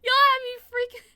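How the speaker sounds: loud, fast and high; blurting out relief in a rush; laughing relief, almost shouting; excited outburst. Real, not acted.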